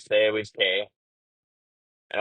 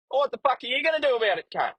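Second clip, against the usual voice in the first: much higher, fast mock taunt; impersonator mouthing off; 12 semitones higher, much faster than usual. Impersonator taunting the angry trucker, fast and cocky. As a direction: rattle it off like a cocky troll. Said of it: "this other person that was impersonating me goes".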